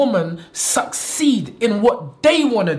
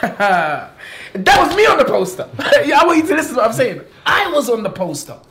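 laughing through the words, proud and giddy; giggling with delight at his own boast; 5 semitones higher, 4 dB louder than usual. Real, not acted. Manager laughing about seeing the poster.

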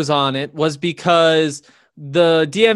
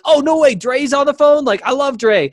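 much higher and louder, retold excitement; thrilled fanboy gush; 10 semitones higher, 3 dB louder than usual. [name] retelling how he thought [name] was on the phone, excited gush. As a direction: gush like you just heard your idol is calling. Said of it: "So I was like".

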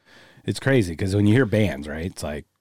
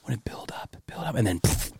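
conspiratorial whisper; stage whisper; 8 dB quieter than usual. Real, not acted. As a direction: whisper it like a secret. Secret plan whispered.

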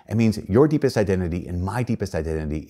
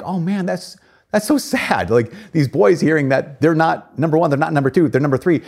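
higher, louder mock pity; pitying dismay; 7 semitones higher, 3 dB louder than usual. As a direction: imitate people finding it sad. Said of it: "they're like".

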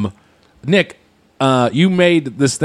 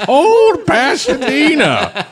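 put-on old prospector voice; 4 dB louder, much slower than usual. Reads fortune in high laughing voice.

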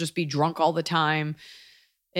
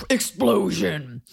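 explosion sound; much slower than usual. Pretend. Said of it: "We don't have any sound effects here".

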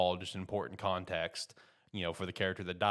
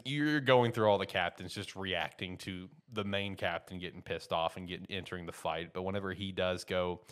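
normal talking voice

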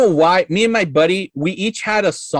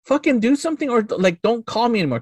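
higher-pitched, retold exasperation; mad; 7 semitones higher than usual. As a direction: replay snapping out of patience, without shouting. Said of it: "I just got mad".

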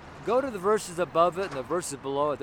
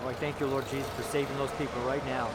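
a quiet, softer prayer of thanks to Jesus; spoken prayer; 4 semitones lower, 4 dB quieter, faster than usual. Real, not acted. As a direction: pray it softly, head bowed. Prays aloud after the sermon.

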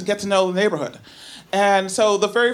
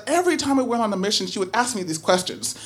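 normal talking voice

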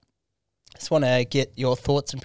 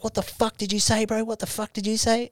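retold angry challenge, pitched up; aggressive demand; 8 semitones higher, 4 dB quieter than usual. Kiwi squaring up to the heckler, aggressive. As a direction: bark it like a drunk tough guy squaring up.

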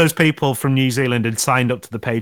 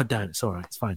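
ordinary talk, nothing marked

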